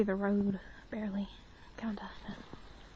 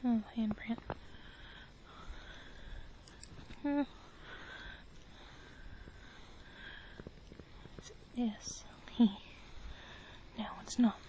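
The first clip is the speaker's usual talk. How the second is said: whispering; 11 dB quieter than usual. Real, not acted. Whispering to camera.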